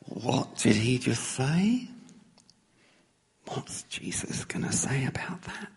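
stunned whisper among friends; hushed shock. Retold or imitated. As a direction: breathe it out in disbelief. Disciples stunned after Peter speaks.

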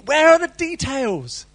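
preacher pleading for details; demanding. Pretend. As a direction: call out for the missing details.

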